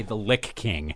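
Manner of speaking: "Lick" ending in k, not ch; "Lick" with hard k. Shown as a demonstration. Host showing co-host how bad it sounds, hard k on "Lick". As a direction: hit the k at the end of "Lick". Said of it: "Doesn't that sound bad?".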